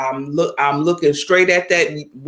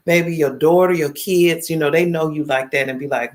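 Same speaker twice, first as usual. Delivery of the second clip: ordinary talk, nothing marked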